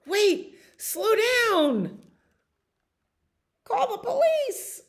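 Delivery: high, frantic calling; squealed comic panic; panicked crowd. Acted. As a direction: call out in mock alarm.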